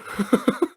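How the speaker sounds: brief laugh at yourself. Real, not acted. Host cracks up at his French attempt, laughing.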